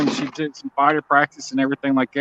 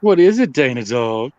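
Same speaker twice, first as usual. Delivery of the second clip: talking to a dog; 3 semitones higher than usual. Real, not acted. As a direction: coax the dog with playful questions.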